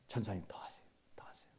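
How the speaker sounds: whispered, egging him on; hushed encouragement. Retold or imitated. Congregant whispering to the angry preacher to go on. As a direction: breathe it like a quiet nudge to keep venting.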